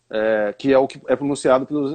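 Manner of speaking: ordinary delivery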